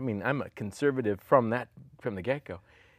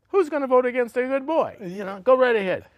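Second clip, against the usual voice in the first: higher and louder, exclaiming; mock exasperation; 13 semitones higher, 9 dB louder than usual. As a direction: toss it back, incredulous and loud. Retorting to mom about voters.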